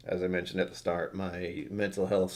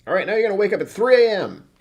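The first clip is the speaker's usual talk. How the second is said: mock winter solstice; 11 semitones higher, 8 dB louder than usual. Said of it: "It's just like".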